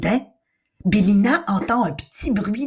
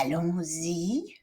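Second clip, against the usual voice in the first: uneasy; 8 dB quieter, slower than usual. Acted. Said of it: "peu rassuré".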